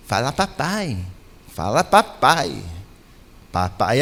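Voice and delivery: low, slow coaxing parent voice; lightly voiced parent teaching a baby its first word; a hint of a parent cooing at a baby; coaxing baby talk.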